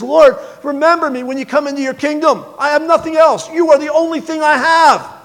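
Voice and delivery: high, urgent plea from the cross; a dying man begging Jesus to remember him; pleading thief. Acted. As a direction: beg Jesus with everything left.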